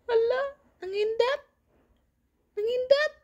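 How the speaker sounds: high, playful teasing coo. Real, not acted. Teasing baby who blinked. (mataas na pabirong boses pambata)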